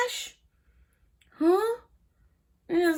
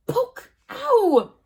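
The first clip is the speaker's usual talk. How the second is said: ordinary delivery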